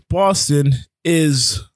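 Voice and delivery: ordinary delivery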